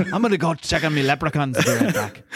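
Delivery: laughing stage Irish brogue; hammed-up Irish brogue, half laughing; mock Irish Banshee. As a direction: put on a silly Irish accent. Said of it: "I just did an impression of".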